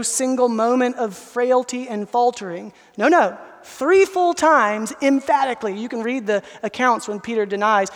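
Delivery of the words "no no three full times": slow, higher and louder; emphatic insistence. Acted. Preacher pressing the point on the congregation, emphatic. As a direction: hammer home every word.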